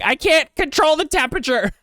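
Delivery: high, loud whiny squeal; exasperated self-quote. Retold or imitated. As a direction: whine it way up high. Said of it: "And I'm like".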